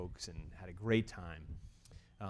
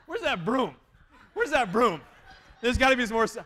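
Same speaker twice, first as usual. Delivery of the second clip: mock panic; 11 semitones higher, 8 dB louder than usual. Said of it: "And I was like".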